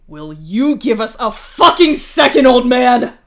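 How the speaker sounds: snarling. Acted. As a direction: snarl at the old man to back off. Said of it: "you snarl at him".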